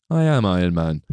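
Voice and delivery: low, deliberate movie-line delivery; Iron Man quote. Retold or imitated. Podcaster quoting the hero's closing reveal, low and deliberate.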